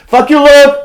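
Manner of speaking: playful shouted jab; shouted insult. Real, not acted. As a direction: yell it through the wall at Luke. Yells at Luke in the house.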